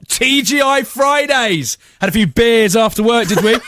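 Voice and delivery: loud, high incredulous officer; accusing policeman. Retold or imitated. Policeman cuts in, mocking the driver.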